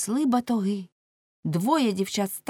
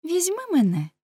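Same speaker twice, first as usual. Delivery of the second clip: put-on high, girlish plea; young girl; 10 semitones higher than usual. A narrator's reading. Sixteen-year-old offering herself, high girlish voice. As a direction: ask in a small high voice. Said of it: "сказала дівчина років шістнадцяти".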